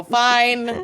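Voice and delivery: slow, rising, drawn-out fine; sing-song forced reassurance; mock-cheerful "fine". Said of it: "Everything's fine".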